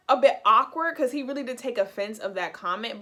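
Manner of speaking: usual speaking voice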